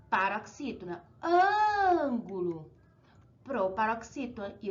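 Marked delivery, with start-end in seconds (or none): 1.2-2.7 ângulo slow, with ÂN long and high; stronger stressed syllable. Shown as a demonstration. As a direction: hold the first syllable of "ângulo" at a raised pitch. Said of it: "o acento é a mais forte".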